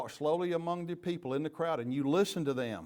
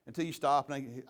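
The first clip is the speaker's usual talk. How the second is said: ordinary talk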